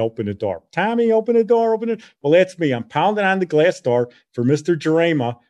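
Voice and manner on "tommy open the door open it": high, pressed mock cry for help; urgent movie plea. Imitating a chased film heroine pleading at a locked door, mock panic. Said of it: "pounding on the door for little Tommy".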